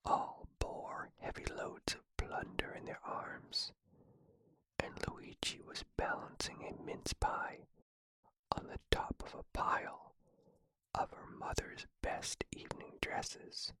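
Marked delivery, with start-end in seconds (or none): none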